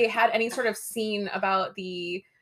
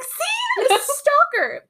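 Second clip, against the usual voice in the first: high, pointed see-I-told-you; high mock told-you-so; much slower than usual. [name] pointing at Kevin in the pawn shop, high told-you-so. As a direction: squeal it like you called it.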